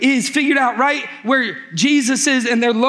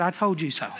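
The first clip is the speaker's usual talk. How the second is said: smug told you so; 4 semitones lower, 6 dB quieter than usual. Acted.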